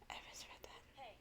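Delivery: breathy whisper, no voice; whispered aside. Real, not acted. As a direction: whisper it to yourself. Aside after a misread line.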